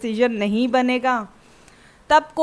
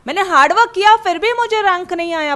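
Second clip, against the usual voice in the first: put-on higher, louder protest as the upset girl; mock yelling daughter; 5 semitones higher, 4 dB louder than usual. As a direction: play the daughter yelling she worked hard, no rank. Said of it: "चिल्ला चिल्ला के बोलेगी". (घर में चिल्लाती बेटी, ऊँची आवाज़ में)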